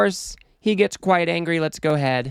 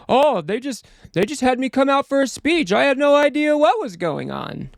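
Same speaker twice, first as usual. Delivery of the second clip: higher-pitched mock-innocent protest; playing dumb; 6 semitones higher than usual. Commentator mocking a politician's excuse about the speech, playing dumb. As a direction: mimic someone acting clueless, pitched up. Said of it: "just play dumb, like".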